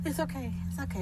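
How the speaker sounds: calm a crying man softly; hushed mock reassurance; soothing. Comforting the burnt-faced actor.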